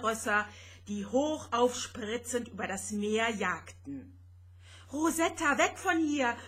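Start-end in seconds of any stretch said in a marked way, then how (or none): 4.9-6.4 higher, louder gasped cry; breathless warning cry to flee; panting shout. A narrator's reading. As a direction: call out breathless and urgent. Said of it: "keuchte Vanya".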